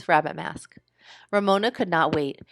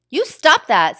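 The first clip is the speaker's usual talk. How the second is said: higher, louder cross scold; 7 semitones higher, 8 dB louder, slower than usual. Acted. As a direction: snap at the teaser. Girl scolds teaser.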